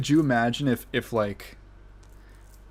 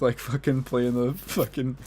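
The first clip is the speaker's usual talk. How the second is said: ordinary talk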